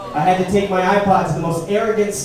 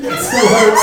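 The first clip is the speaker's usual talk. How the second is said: a tiny, pained squeal; squeaky high voice; 4 dB louder than usual. Acted. Comic squeaking the punchline about his bee sting, far higher.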